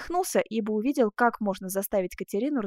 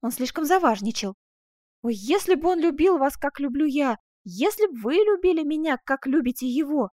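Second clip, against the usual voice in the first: higher, emphatic lovelorn plea; animated, pitched-up heroine; impassioned young woman character; 4 semitones higher, 5 dB louder than usual. Acted. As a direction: voice a girl passionately protesting her love. Impassioned pleading girl, voiced.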